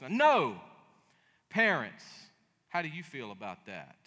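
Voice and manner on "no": sudden louder no; mock child no. Child denying parent a sip, loud mock no. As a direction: bark a childish refusal.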